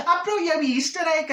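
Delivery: high, put-on voice of her pal; Minnie's voice. [name] imitating Minnie handing over a tiny egg. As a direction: pitch it up like a proud gift-giver. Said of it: "She says".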